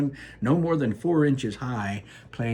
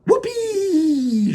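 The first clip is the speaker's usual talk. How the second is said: high, loud gleeful yell; shouted whoop; 7 dB louder than usual. Acted. Mike TV spots the television chocolate, whooping loudly. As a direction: cheer it out like a kid who spotted his prize. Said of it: "shouted Mike T V".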